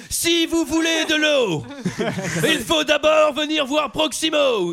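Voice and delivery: loud, high, drawn-out orator; Proximo speech mode. Acted. Man answers a water request with a speech, booming oratory. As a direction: proclaim it like a grandiose speech, slowly. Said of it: "ne parle qu'en speech".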